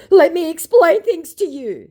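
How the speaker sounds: high, loud, rushed mock scammer; hasty mock scammer. Scammer hastily cutting in, high and loud. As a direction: blurt it out like a caught liar. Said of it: "he hastily puts in".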